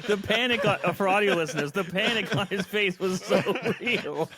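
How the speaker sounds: laughing while talking; giggling through the words. Real, not acted. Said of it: "laughing at the choke".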